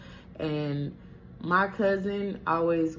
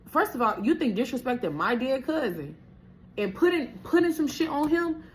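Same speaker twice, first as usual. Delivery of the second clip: much higher and louder indignant tirade; outraged rant; 11 semitones higher, 4 dB louder than usual. Real, not acted. Scolding her ex for insulting her dead cousin, indignant. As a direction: let him have it, incredulous.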